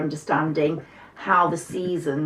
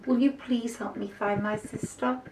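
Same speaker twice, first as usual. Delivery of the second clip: pleading little mouse; 6 semitones higher, 5 dB quieter than usual. Acted.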